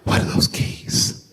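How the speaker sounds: breathless whispered question; wife whispering in surprise at the unknown keys; hushed gasp question. Retold or imitated. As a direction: ask it in a stunned hush. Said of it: "She said, gasps".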